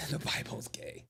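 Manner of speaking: soft whispered aside. Retold or imitated. Streamer echoing a chat remark about the Bible, whispered. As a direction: whisper it under your breath.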